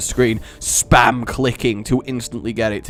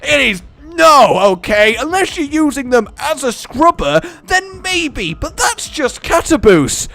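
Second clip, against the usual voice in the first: much higher, louder mock-angry rant; 10 semitones higher, 7 dB louder than usual. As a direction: rant in fake outrage at bald people. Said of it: "I just got angry at bald people".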